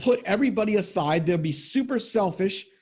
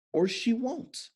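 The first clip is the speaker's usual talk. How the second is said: ordinary talk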